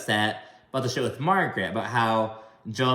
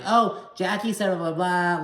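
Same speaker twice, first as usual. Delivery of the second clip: higher-pitched imitation of a heated interruption; coming in hot; 7 semitones higher than usual. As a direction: mimic someone butting in, pitched up. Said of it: "she kinda coming in hot".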